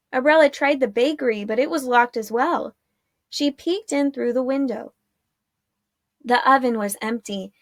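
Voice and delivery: usual speaking voice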